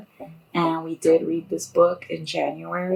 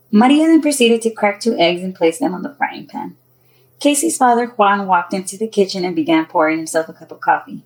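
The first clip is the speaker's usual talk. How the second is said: louder, projected storytelling voice; story narration; 3 semitones higher, 9 dB louder than usual. A narrator's reading. Author tells of two girls waking, story narration. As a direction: read it out clearly as a storyteller.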